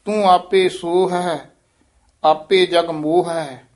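ਸੋਹ and ਮੋਹ said as short single words, not ਸੋਹੇ ਮੋਹੇ; correct Gurbani pronunciation. Shown as a demonstration. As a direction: stress ਆਪੇ and keep ਸੋਹ ਹੈ separate. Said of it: "ਇਸ ਤਰ੍ਹਾਂ ਉਚਾਰਨ ਕਰਨਾ".

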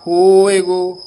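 "ਹੋਏਗੋ" said loud and set apart; stressed pronunciation. Shown as a demonstration. Teacher stresses ਹੋਏਗੋ to show how it is pronounced. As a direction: punch ਹੋਏਗੋ out on its own. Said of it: "ਲਾਂ ਲੱਗ ਗਈ ਈੜੀ ਦੇ".

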